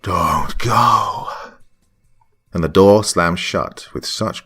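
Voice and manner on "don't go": a hint of a louder, otherworldly cry; ungodly scream. Ghost screaming at the narrator not to leave, ungodly cry. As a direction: shriek it like a demon. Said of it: "she screamed in an ungodly voice".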